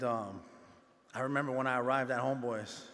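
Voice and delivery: usual speaking voice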